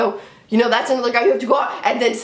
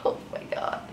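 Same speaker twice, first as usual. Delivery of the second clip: breathy laugh; 12 dB quieter than usual. Real, not acted. Exasperated Knicks fan rant.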